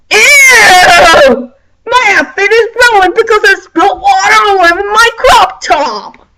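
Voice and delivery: high, whiny mock shriek, fast; hammed-up whine about a spill. Popular girl complains about water on her crop top, whiny. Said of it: "popular bitches can get whiny".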